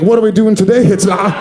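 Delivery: a cartoon German Shepherd asks in a hammed-up German accent; talking dog in a jokey German accent. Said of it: "He's got a German accent".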